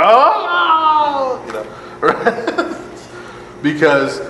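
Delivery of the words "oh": high mock funeral wail; a hammed-up hired mourner's wail. Mourners at the funeral, a hammed-up wail. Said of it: "to wail and scream and go".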